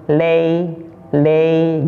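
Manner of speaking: "Lei" drawn out like lay; ei example. Shown as a demonstration. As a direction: glide "Lei" from eh to i. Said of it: "gitu ya".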